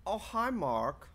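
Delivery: slow, high, mock line delivery; lightly imitated cult film line; quoted movie line.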